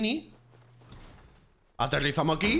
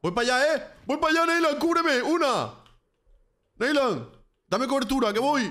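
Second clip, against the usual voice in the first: excited shouting; 9 semitones higher than usual. Real, not acted. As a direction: yell for cover in the heat of battle. Urgent callouts in firefight.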